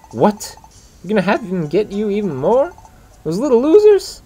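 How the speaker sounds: greedy punk thrilled by the con; gleeful glee. Acted. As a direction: squeal with delight at the scheme. Scraggy gleeful at the scam.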